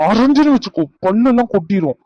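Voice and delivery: high loud threatening shout; angry threat. Acted. Husband threatens wife over skipped meal, angry shout. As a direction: threaten her, fists ready.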